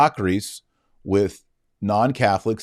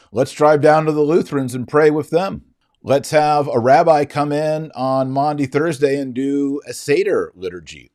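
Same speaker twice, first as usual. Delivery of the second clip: mocking; 6 semitones higher, 4 dB louder than usual. Mocking post Vatican II attitude.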